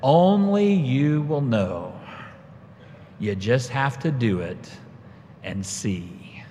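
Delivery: slow, deliberate, dropping away at the end; measured verdict. Retold or imitated. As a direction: lay it out slowly, then let it fall. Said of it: "she looked up at me and said".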